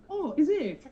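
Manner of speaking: high, laughing mock surprise; surprised customer. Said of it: "I'm Algerian".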